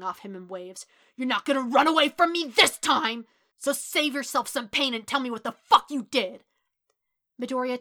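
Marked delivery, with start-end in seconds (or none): 1.2-6.4 loud, high raging threat; menacing furious shout; vicious snarl. A narrator's reading. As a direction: snarl it with anger pouring off you. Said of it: "teeth were bared in a vicious snarl, his anger radiating".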